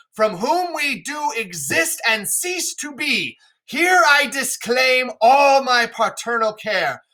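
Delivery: hammed-up royal fury in a classroom reading; Lear's furious oath. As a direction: curse her as a raging king casting off his child. Lear's curse disowning Cordelia.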